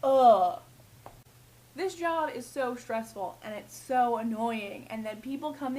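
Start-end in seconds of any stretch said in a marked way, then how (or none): 0.0-0.6 loud, fed-up groan of a rude customer; disgusted groan. Retold or imitated. Rude customer groaning at the clerk, loud disgusted ugh. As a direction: groan it out, annoyed. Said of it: "crappy attitude like".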